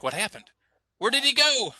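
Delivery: a startled, curious outburst; surprised listener. Retold or imitated. Imitating a listener left hanging, loud surprised question. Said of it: "and you're like".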